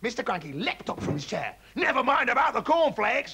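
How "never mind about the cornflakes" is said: high, loud brush-off; dad leaping up from breakfast; excited cry. A narrator's reading. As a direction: wave the cornflakes away. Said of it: "he cried".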